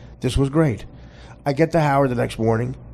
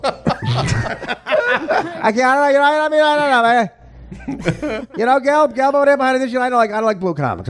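Russo impression; 11 semitones higher, faster than usual. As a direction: push it way up into a rambling impression. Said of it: "Mandel Russo said".

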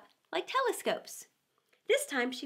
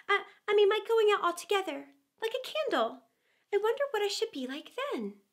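put-on higher, halting childlike voice; worried Alice; 7 semitones higher than usual. A narrator's reading. Alice picturing herself going out like a candle, higher and uneasy. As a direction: stumble a little, uneasy. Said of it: "said Alice to herself".

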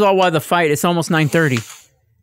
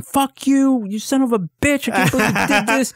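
high-pitched mock outrage; hammed-up indignant rant, pitched way up without shouting; outraged cursing; 9 semitones higher than usual.

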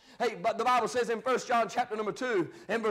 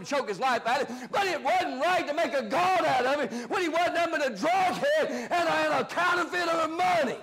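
fired-up preaching; 4 semitones higher than usual. Real, not acted. Preacher rebukes the congregation over false heroes, fired-up preaching.

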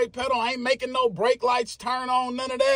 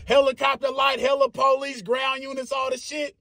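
normal talking voice

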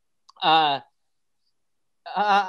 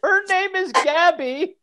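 whiny complainer; 10 semitones higher, 4 dB louder, slower than usual. Acted. Mock reading of the complaint letter.

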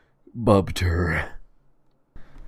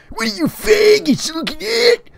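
very high, loud yell; screaming; 8 dB louder than usual. Acted. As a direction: scream it at the staring crowd. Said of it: "He screams".

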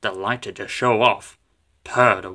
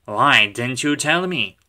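plain reading voice

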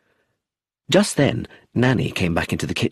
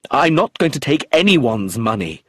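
excitedly; 5 semitones higher, 4 dB louder than usual. A narrator's reading. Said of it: "Roger said excitedly".

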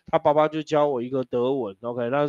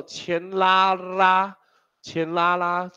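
drawn-out demo; 4 semitones higher, much slower than usual. Shown as a demonstration. Demonstrating pull-the-sound-forward rule.

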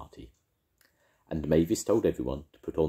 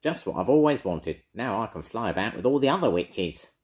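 wicked witch voice; 7 semitones higher, 5 dB louder than usual. Acted. Edna voiced.

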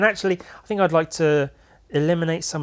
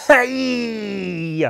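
surprised; 8 semitones higher, 5 dB louder than usual. Real, not acted. Surprised exclamation at drawing.

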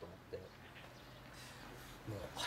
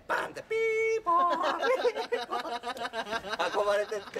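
siren imitation; 9 semitones higher than usual. サイレンの擬音.

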